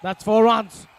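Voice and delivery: loud, high excited call; excited shout. Real, not acted. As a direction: erupt as the ball hits the rope. Boundary hit, commentator erupts.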